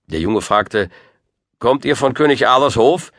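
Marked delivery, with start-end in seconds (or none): 1.6-3.1 put-on high, eager boy's voice; young boy. A narrator's reading. Boy asking about King Arthur's court, eager. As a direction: ask high and louder, like an eager lad.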